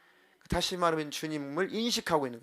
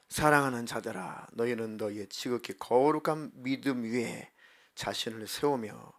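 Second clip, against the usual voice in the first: slow emphatic reading; 4 semitones lower, slower than usual. Shown as a demonstration. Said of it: "rereading the verse slowly".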